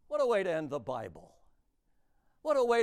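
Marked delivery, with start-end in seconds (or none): none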